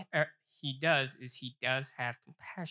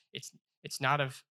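ordinary talk

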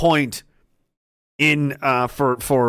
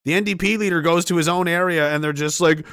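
ordinary delivery

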